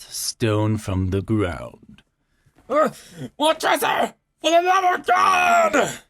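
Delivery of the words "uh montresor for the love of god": high, loud crying shout; desperate scream. A narrator's reading. Man being walled in screams to Montresor, desperate. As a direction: scream for mercy, voice cracking.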